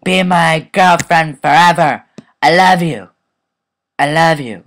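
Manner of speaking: loud, high, gushing lovestruck declaration; over-the-top mushy love talk; mock lovesick boy. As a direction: ham up a clingy boyfriend. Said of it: "be like".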